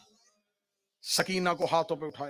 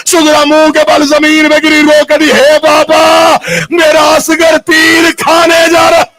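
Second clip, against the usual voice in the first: loud, higher, slow wailing; wailing lament cry; 8 semitones higher, 22 dB louder than usual. Acted. Preacher voicing Sughra's cry as Asghar leaves, wailing. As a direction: cry out the daughter's grief.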